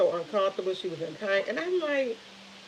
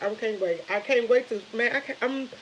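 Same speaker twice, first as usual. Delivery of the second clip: ordinary talk, nothing marked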